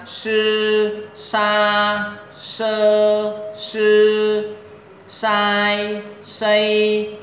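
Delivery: high, loud, level syllable chanting; sustained high-level tone on every syllable; syllable drill. Shown as a demonstration. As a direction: hold each syllable high and even, like a pronunciation drill.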